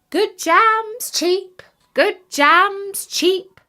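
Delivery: high, slow market call; peasant woman crying her wares. Acted. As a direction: sing out the jam like a street seller.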